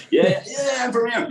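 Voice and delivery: high, brash imitated New York accent; teasing New York accent, pitched high; mock New York accent. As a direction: play a proud New Yorker showing off his New York accent. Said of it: "Don't you notice by my accent".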